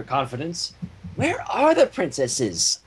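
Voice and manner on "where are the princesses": high, slow squeaky pony voice; high slow question in a put-on voice; Pinkie's question. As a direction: ask it in a cartoon pony voice. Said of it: "asked Pinkie".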